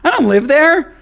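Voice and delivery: laughing disbelief. Retold or imitated. Mocking reply, laughing.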